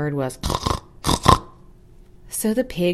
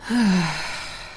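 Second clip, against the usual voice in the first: long bored sigh; hen's heavy sigh. Acted. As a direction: heave a big bored sigh. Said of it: "the hen heaved a sigh".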